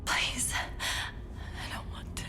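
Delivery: slow, breathless whisper of refusal; barely voiced, slow plea; frightened whisper. Cortana pleads, scared.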